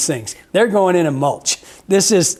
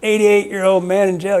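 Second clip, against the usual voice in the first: high and loud mock defiance; defiant old man; 6 semitones higher, 4 dB louder than usual. Quoting the old vet daring the warden to jail him, defiant. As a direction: scoff at the warden, hammed-up. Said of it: "He goes".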